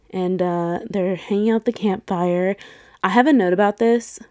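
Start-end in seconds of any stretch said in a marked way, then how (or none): none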